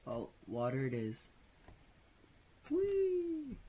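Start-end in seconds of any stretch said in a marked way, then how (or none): 2.7-3.6 high squeal of delight; excited whee. Real, not acted. Sliding down into water.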